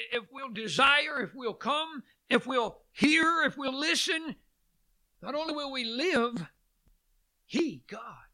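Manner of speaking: ordinary delivery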